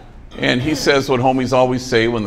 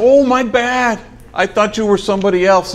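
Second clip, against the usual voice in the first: a loud, much higher, sheepish backpedal; homie's apology; 10 semitones higher, 4 dB louder than usual. Retold or imitated. Big homie apologizing to the scared family, loud and high. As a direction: wave it off loudly.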